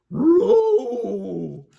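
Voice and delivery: high, loud dog yelp of fright; startled cartoon dog cry; Scooby yelp. Scooby grabbed in the dark.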